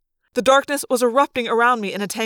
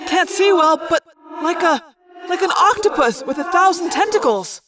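yelled; 7 semitones higher than usual. A narrator's reading. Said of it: "Tobias yelled down from above".

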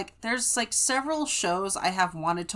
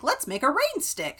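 Blippi; 7 semitones higher than usual. Retold or imitated. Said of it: "he's like".

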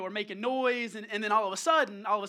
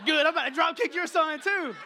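loud, higher comic outburst; retold mock threat; 5 semitones higher, 7 dB louder than usual. Dad texting another dad about his son, loud mock threat. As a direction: blurt a mock threat, loudly.

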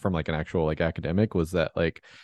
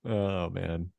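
ordinary talk, nothing marked

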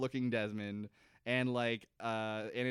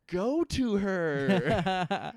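hammed-up dramatic plea; 8 semitones higher, 7 dB louder, much slower than usual. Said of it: "mock dramatic movie urging".

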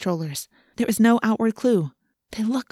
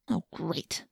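much softer, muttered to oneself; muttering; 11 dB quieter than usual. A narrator's reading. Girl alone in the rain over her ruined shoes, muttering. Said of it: "I muttered".

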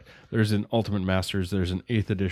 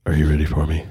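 low, seductive crooner voice; sultry baritone voice; 7 semitones lower, 6 dB louder, faster than usual. Pretend. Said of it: "serenade you with my sultry baritone voice".

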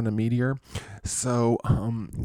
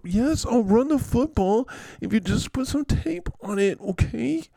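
very high, exasperated whine; whiny injured player bargaining, hammed up; Saquon; 12 semitones higher, 3 dB louder than usual. Player promising coach to run if taped, high whining.

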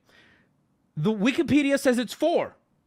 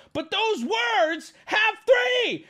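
very high, stretched-out insistence; slow, high cry of real exasperation; exasperated shout; 11 semitones higher, much slower than usual. Real, not acted. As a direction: drag the words out high, at the end of your patience.

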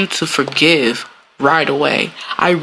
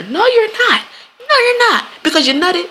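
high, incredulous no; mocking retort. To the cheater, a high mocking comeback. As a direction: shoot the excuse down, pitched way up.

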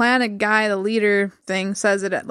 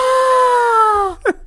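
loud, high gasp; shocked gasp; 13 semitones higher, 5 dB louder than usual. Real, not acted. Friend watching a startling parody clip, shocked gasp. Shocked at what the video shows.

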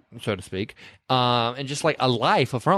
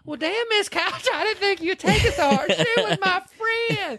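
nurse voice. Retold or imitated. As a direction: lay it on like a sweet Southern nurse.

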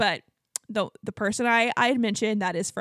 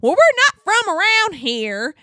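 loud, high, drawn-out Texan; slow hollered Texas twang; thick Texas accent; 10 semitones higher, 8 dB louder, slower than usual. Retold or imitated. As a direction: imitate a boisterous Texan making conversation. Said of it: "he has the thickest Texas accent".

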